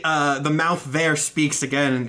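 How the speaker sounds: ordinary delivery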